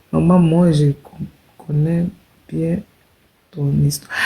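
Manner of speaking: slow, low, word-by-word reading; halting read-aloud. Retold or imitated. Reading a viewer's comment to the live audience, halting read-aloud.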